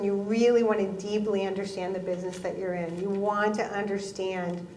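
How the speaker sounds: normal talking voice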